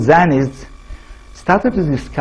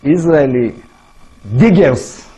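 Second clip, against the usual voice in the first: normal talking voice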